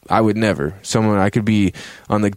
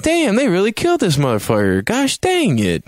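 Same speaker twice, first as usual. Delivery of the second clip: high-pitched comic lament; mock dismay; 11 semitones higher than usual. Player reacting to the funeral, hammed-up dismay. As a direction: whine it in exaggerated upset.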